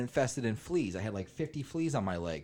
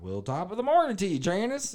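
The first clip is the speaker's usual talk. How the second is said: high, folksy country greeting; hammed-up local accent, pitched up; 8 semitones higher than usual. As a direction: greet a neighbor in a mock country twang. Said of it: "everybody was like".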